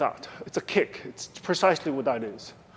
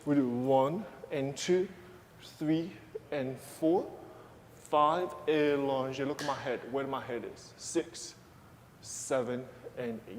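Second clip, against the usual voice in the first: quiet, calm counts under the music; soft counting; 7 dB quieter than usual. Shown as a demonstration. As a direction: guide the tendus gently and softly. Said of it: "soft counts over music".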